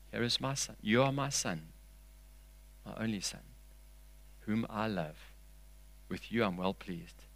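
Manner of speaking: very soft, intimate quote; quiet acted voice of a loving father; hushed tenderness. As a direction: say it gently, almost under your breath. Voicing God speaking of his Son.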